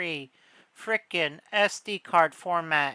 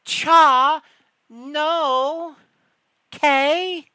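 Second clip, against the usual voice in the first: much louder and higher, a scoffing "no"; 9 semitones higher, 9 dB louder, much slower than usual. Real, not acted. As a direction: shut the idea down hard, louder and higher. Scoffing at Nintendo's price promise.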